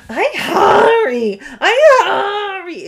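high, loud, drawn-out wail; hammed-up imitation of a starving toddler; dying-of-hunger child. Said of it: "acts like she's gonna die".

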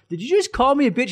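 high, loud, disbelieving retort; indignant challenge. Retold or imitated. To the guy who insulted him: high indignant challenge. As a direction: call him out, offended.

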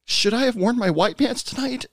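high, lightly mocking lonely guy; high mock-hopeful inner voice of a lonely barfly. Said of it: "the guy starts wondering".

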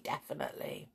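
definitely breathed out unvoiced; whisper. Shown as a demonstration. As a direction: let definitely fade to a whisper. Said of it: "fading repetition".

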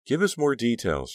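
eager, pushy demand. A narrator's reading. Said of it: "Trish demanded".

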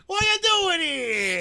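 high-pitched mock heckle; playful imitation of a shout. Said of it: "keep shouting at her".

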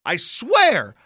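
very high, loud mock-desperate yell; slow, overblown yelling protest; exasperated shout. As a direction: shout that you are through, hammed up. Swears off rhymes after relapsing.

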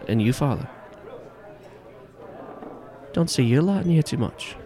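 put-on lilting Irish accent, rising high; Irish barman making small talk; Irish bartender. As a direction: greet a regular priest in an Irish brogue. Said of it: "this strong-built Irishman".

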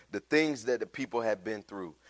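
normal talking voice

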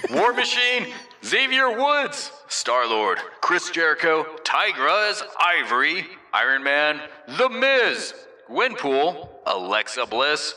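drawn-out ring announcer, pitch swooping high; flavored announcer read. Shown as a demonstration. Host reading the Avengers roster, full announcer voice. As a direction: hype each name like a wrestler's entrance. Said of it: "add a little bit of flavor".

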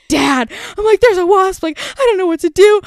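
high, loud mock panic; retold freak-out. Retelling the call to dad about the wasp, freaking out. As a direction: plead as if freaking out on the phone. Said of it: "I'm freaking out".

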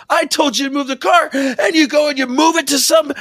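high loud mock shout; shouting guard. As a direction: yell like the angry guard. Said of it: "He goes".

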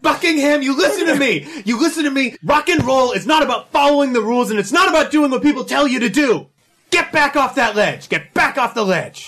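fervent, high-pitched rallying cry; urgent pleading shout. Acted. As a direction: order them back from the edge. Student talking teacher off ledge.

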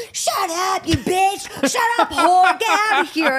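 high, crotchety mock yell; imitated yelling old lady. Old lady telling driver to get lost, mock yelling. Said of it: "yelling at me like this".

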